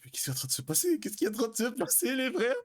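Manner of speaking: what is happening, rising high in disbelief; stunned disbelief. Retold or imitated. Stunned inner reaction to instructor. (sidéré, la voix qui monte très haut)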